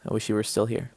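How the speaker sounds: usual speaking voice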